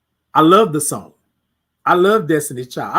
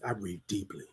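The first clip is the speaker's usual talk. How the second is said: much softer, lower, almost murmured reply; quiet answer; 10 semitones lower, 17 dB quieter than usual. Shown as a demonstration. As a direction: answer yourself very softly. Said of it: "Titan, how do you breathe?".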